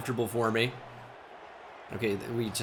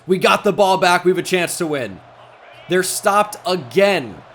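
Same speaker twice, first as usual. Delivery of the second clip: excited shout; 4 semitones higher, 6 dB louder than usual. Real, not acted. Defense gets a stop.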